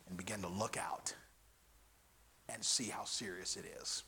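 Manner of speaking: very low, soft, nearly whispered preaching; grave hush. Real, not acted. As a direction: drop to a hush with long pauses. Preacher turns grave after joke.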